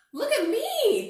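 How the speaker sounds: very high, loud show-off call; excited cry. A narrator's reading. Bear cub showing off his new hat, very high and loud. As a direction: shout it out with glee. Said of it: "Douglas cried".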